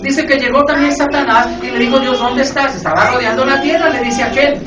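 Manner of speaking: loud, driving sermon delivery; full-force preacher; fiery preaching. Real, not acted. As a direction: preach it hard to the congregation.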